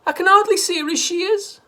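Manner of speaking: high, loud wail. A narrator's reading. Missus Cranky wailing over Grandma, high and loud. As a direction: wail in despair.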